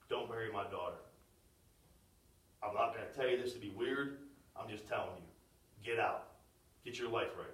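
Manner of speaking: very soft, low, measured voice; quiet fatherly counsel. Retold or imitated. Girl's dad privately warning off the boyfriend, hushed low voice. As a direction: imitate a dad speaking low and in confidence. Her dad's private kitchen advice.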